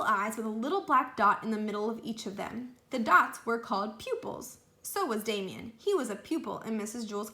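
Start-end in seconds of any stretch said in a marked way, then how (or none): none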